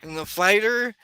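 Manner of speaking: put-on high-pitched enthusiastic kid gamer; Tony, eager. Game master as Tony offering to introduce his DM, eager.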